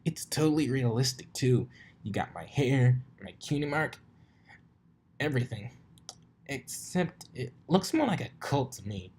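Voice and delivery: rubbing it in with glee; teasing Rainbow voice. Acted.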